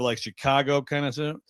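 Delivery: usual speaking voice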